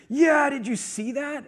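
high, loud, drawn-out excitement; re-enacted dad brag, slow and big; bragging dad. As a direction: gush like a proud dad showing off. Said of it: "like, dad brag mode".

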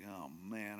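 low, quiet dejection; soft, sunken groan of resignation; depressed. Retold or imitated. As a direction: mutter it, crestfallen. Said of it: "he's just so depressed".

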